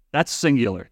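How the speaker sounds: normal talking voice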